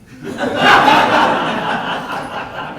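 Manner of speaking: loud breathy burst of laughter; real laugh. Real, not acted. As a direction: break up laughing. Reading embarrassing anecdote.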